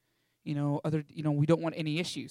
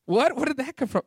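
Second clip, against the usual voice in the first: baffled friend; 7 semitones higher, 4 dB louder than usual. Retold or imitated. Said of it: "And he goes".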